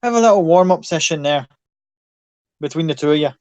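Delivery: put-on loud Irish accent; Irish fight host, loud. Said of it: "Your Irish accent is fantastic".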